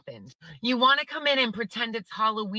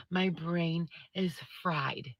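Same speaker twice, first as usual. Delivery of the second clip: slower, softer, drained repeat; exhausted mutter; 4 semitones lower, 7 dB quieter, slower than usual. Real, not acted. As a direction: let it sink out, spent.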